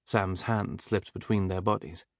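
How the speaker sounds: usual speaking voice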